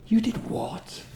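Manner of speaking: high, imitated incredulous question; mock-horrified, pitched right up; aghast disbelief. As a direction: ask it as if appalled. Colleagues react aghast to his news.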